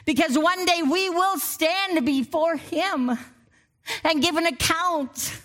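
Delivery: rising, ringing preacher's cadence; full-force preaching. Acted. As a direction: lift the congregation with each line. Preaching continues.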